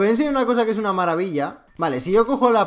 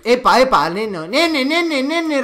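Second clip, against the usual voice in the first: alarmed shout; 6 semitones higher, 5 dB louder, slower than usual. Real, not acted. Startled under fire.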